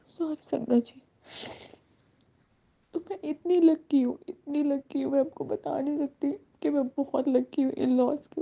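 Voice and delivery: real tears behind the words; emotional, tearful. Real, not acted. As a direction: voice breaking with real emotion as she talks about her mother-in-law. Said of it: "sorry, मैं थोड़ा emotional हो गई".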